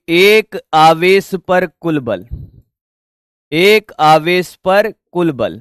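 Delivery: slow, deliberate dictating pace, एक stressed; dictating to students; slow dictation. Shown as a demonstration. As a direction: spell it out slowly for note-taking. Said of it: "teacher repeats slowly for dictation".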